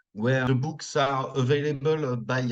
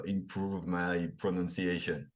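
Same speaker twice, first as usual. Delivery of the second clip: lower, softer, careful polished English; improved pronunciation; 6 semitones lower, 8 dB quieter than usual. Shown as a demonstration. Frenchman showing off his improved English pronunciation. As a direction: put on your best effort at a clean accent. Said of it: "I can make an effort to".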